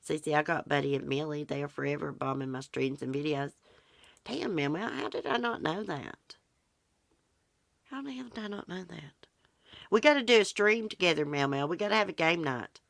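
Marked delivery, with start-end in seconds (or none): 4.2-9.3 high, soft disbelief; quiet, high-pitched wonder at news; real astonishment. Real, not acted. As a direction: react amazed, pitched up but hushed. Reacting to news in the chat.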